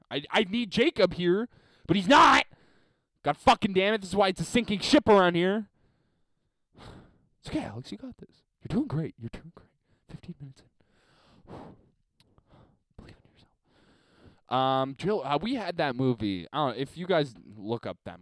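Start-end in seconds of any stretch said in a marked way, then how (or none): 0.1-5.7 loud, high venting; raised-voice frustrated host; exasperated outburst. Real, not acted. Host mock-panics over absent co-host. 7.4-13.5 hushed, soft self-reassurance; very quiet little pep talk. Pretend.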